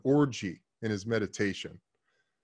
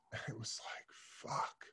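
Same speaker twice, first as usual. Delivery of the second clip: soft unvoiced whisper, struck by insight; a hushed, stunned "fuck"; awed whisper; 3 semitones lower, 19 dB quieter than usual. Real, not acted. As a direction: breathe it out as if the words just hit home. Struck by the guru's words.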